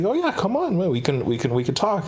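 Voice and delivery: high, eager voice of an overly friendly missionary; cheerful young missionary acting sweet. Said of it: "He's like".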